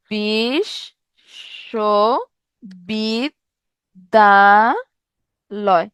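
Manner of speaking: "বিশ্ববিদ্যালয়" drawn out extremely slowly, each syllable held long; low, dragged syllables of "বিশ্ববিদ্যালয়", each sliding upward; spelling-out pace. Shown as a demonstration. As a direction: stretch every syllable of "বিশ্ববিদ্যালয়" as if writing it along. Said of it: "একদম বাংলিশে লিখে ফেলবো".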